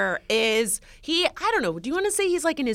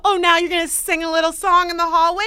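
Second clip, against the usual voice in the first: raised, teasing imitation of a neighbor; mock smug; 6 semitones higher, 4 dB louder than usual. Neighbor catches her singing in hallway, smug imitation. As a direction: needle someone you think you caught out. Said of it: "he said it so smugly".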